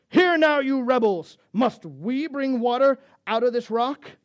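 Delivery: loud, high angry outburst; frustrated Moses. Acted. Preacher voices Moses rebuking the grumbling people, frustrated shout. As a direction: shout it in exasperation at the crowd. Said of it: "He's frustrated".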